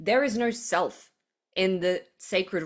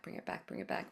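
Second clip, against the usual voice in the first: whispered to herself, nearly soundless; 14 dB quieter, faster than usual. Real, not acted. Speaker recovering her lost thought, whispering to herself. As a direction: breathe the words to yourself. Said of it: "lost my train of thought".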